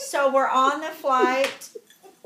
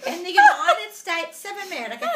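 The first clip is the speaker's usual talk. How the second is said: higher, loud put-on English accent; mimicked English accent; 5 semitones higher, 7 dB louder than usual. Confirming her country to the English lady, loud English accent. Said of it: "we're talking like this the whole time".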